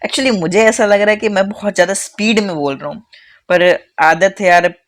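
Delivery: usual speaking voice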